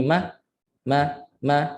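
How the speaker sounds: quick short "ma", the vowel cut off; half beat. Shown as a demonstration. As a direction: clip each "ma" to half length. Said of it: "itu setengah harakat". ("ma" setengah harakat)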